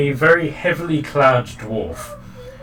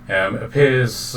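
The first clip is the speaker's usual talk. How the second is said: normal talking voice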